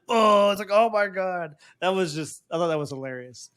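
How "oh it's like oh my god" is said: loud, high cry of alarm; mock shock. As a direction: exclaim it like someone suddenly zapped. Acting out a shocked prisoner.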